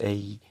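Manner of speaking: ei soft and drawn out; Catalan ei. Shown as a demonstration. Teacher demoing the Catalan word for the. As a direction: say ei with the final ll sound. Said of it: "en catalán es ei".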